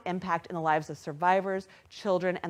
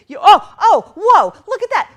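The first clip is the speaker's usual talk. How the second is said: real excitement, high and loud; startled into joy by the total; excited; 11 semitones higher, 16 dB louder than usual. Real, not acted. Host seeing the goal broken, excited outburst. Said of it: "Sorry, I just got excited".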